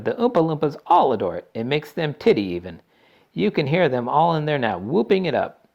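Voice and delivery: high, lively showman; delighted candy maker; Wonka voice. A narrator's reading. Wonka describes Oompa Loompas.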